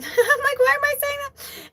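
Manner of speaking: very high, squeaky laughing self-mockery; laughing squeak. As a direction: squeal it through a laugh at yourself. Laughing at own oversharing.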